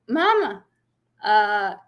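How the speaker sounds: imitated toddler announcing a visitor, pitch jumping up; two-year-old. Toddler tells mother who is coming, imitated child voice. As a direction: mimic a small child calling Mama. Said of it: "my two-year-old, goes".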